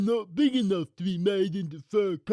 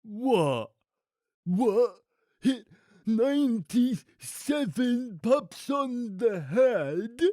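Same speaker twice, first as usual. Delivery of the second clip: aghast, high henchman voice, words drawn out; shaky; 9 semitones higher, 4 dB louder, much slower than usual. A narrator's reading. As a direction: falter in horror at the order. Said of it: "he said shakily".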